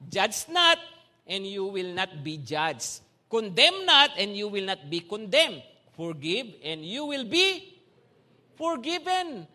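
slow, weighty scripture reading. Acted. Preacher reading scripture to the congregation, solemn and slow. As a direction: proclaim the verses with weight.

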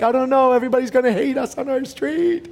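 high, hammed-up parental worry; mock panic. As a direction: wail it like a doomed parent. Worried parents, retold.

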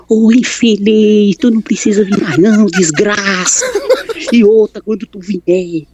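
slow drawn-out yell; shouting her out, dragging it; retold yell. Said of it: "mas ela gritou". (grito arrastado)

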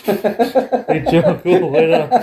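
high mock call breaking into laughs; laughing teasing call. Triple H teasing Vince as he walks off, high laughing call. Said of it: "I love Triple H".

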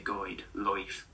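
"guide" and "life" said with an oi sound, like "loif"; oi vowel. Shown as a demonstration. Teacher showing the West Country vowel, "life" as "loif". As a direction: turn the I of "life" into oi. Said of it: "like that".

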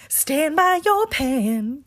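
high, drawn-out catchphrase; TikTok cook imitation. Quoting a TikTok cook to her roommate, high slow imitation. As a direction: stretch it out like an old-school cooking-video host. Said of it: "TikToks that are like".

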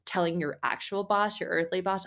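ordinary delivery

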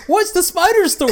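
high, puzzled listener voice; curious listener. Retold or imitated. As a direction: ask it like a confused fan. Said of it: "People are gonna be like".

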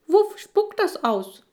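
very high, slow squeaky voice. Acted. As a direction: squeak the name out loud. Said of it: "piepst der Breitschultrige".

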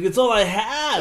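mock pleading. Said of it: "He's like".

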